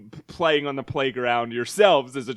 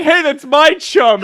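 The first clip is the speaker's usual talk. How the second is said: indignant yell; 12 semitones higher, 12 dB louder, slower than usual. Retold or imitated. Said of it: "And they're like".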